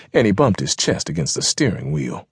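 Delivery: ordinary delivery, nothing marked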